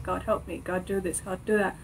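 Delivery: low, soft retold prayer; muttered plea. Student retelling prayers during every assessment, muttered pleading. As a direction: murmur short pleas under your breath.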